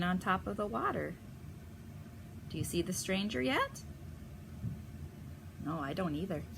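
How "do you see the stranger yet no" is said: usual speaking voice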